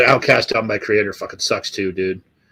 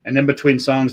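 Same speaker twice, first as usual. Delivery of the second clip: ordinary delivery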